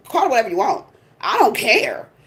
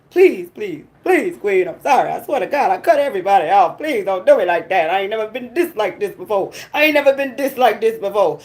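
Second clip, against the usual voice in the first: mock begging man; 2 semitones lower than usual. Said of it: "on my voicemail begging".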